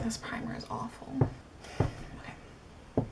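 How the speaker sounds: hushed muttered complaint; whispering. Real, not acted. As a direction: whisper it under your breath.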